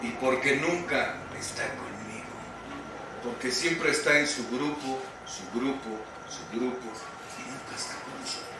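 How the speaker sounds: put-on soft, fading child's complaint, nearly whispered at the end; a touch of tearful daughter. Daughter telling why her father is never home, quiet and hurt. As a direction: let the daughter's hurt trail away.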